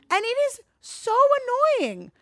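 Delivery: climbing into a high register, worked up. Real, not acted. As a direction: voice jumps up high on so. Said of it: "getting into the high register".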